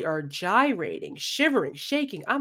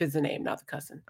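whispered aside; 4 semitones lower, 8 dB quieter, faster than usual. Real, not acted. Quiet aside.

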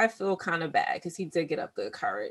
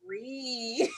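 laughing; 7 semitones higher than usual. Real, not acted.

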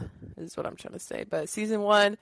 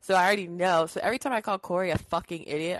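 ordinary delivery

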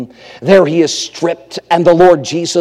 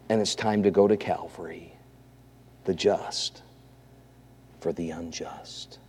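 low voice fading to almost nothing; grave, very soft refrain; solemn hushed preaching; 9 semitones lower, 13 dB quieter than usual. Real, not acted. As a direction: leave long pauses and let each phrase sink.

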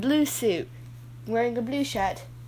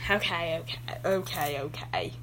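reluctant sigh; 5 semitones lower, 6 dB quieter than usual. Acted. Reluctant fading okays.

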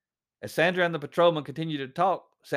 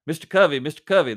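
calling his name loudly down the line; loud into the phone; 2 semitones higher, 8 dB louder than usual. Retold or imitated. Patrolman calling the driver on the phone, loud. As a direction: raise your voice to get his attention. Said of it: "the patrolman said loudly into the phone".

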